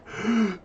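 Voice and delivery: sharp high mock gasp as if going under water. Player imitating holding breath underwater, mock gasp. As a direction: gasp in for air like the avatar dives. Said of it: "you're like".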